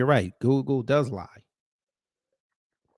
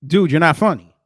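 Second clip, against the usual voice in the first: scolding; 3 semitones higher, 9 dB louder than usual. Real, not acted. Calling out [name]'s joke.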